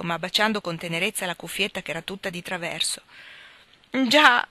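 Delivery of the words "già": high, loud, choked-up wail; a broken sob of a single word; desperate sob. A narrator's reading. Meg sobs in despair over the ruined jam. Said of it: "singhiozzava Meg disperata".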